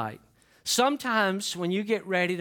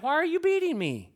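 talking donkey; 10 semitones higher than usual. Retold or imitated. Donkey voiced in Bible story.

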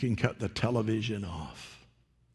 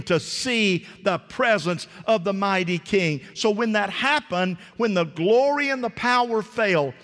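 higher, louder fiery preaching; preaching at full force; 9 semitones higher, 5 dB louder than usual. Acted. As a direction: preach it with all your fire to the congregation.

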